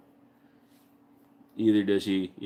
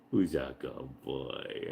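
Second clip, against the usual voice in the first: low, slow, softer cooing; soft dog praise; 7 semitones lower, 8 dB quieter, much slower than usual. Real, not acted. Praising his dog quietly, slow and low. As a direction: murmur it slowly to the dog.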